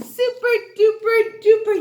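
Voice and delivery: high, loud and fast cheer; excited praise. Real, not acted. Teacher cheering her class, high and loud. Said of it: "teacher praising class".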